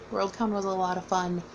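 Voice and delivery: normal talking voice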